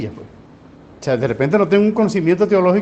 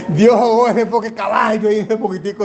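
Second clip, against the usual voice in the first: laughing; 4 semitones higher than usual. Real, not acted. Laughing at the joke.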